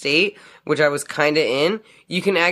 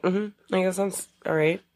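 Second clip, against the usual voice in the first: unimpressed; 4 semitones higher, 5 dB quieter than usual. Retold or imitated. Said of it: "I was always just kinda like".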